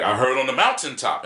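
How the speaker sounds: loud, high declamation rising toward the end. Retold or imitated. Showing how the poet delivers a line, in loud preacher style. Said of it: "it's like a Baptist preacher".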